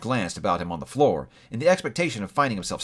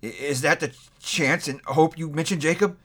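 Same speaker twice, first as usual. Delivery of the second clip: higher, stammering dismay; faltering voice; 6 semitones higher than usual. A narrator's reading. Scrooge asks the ghost in dismay, faltering. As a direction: stumble over the question. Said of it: "he demanded in a faltering voice".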